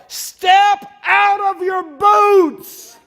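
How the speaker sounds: slow, high, very loud yell. Acted. Preacher yelling at person stuck in the mud, slow high yell. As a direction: bellow the command slowly, word by word. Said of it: "And I would yell".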